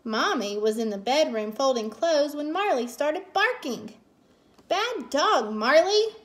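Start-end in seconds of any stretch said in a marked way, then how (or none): 4.7-6.1 higher, louder telling-off; scolding. A narrator's reading. Mommy scolds Marley for barking, cross and raised. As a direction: scold the dog sharply. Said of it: "Mommy scolded".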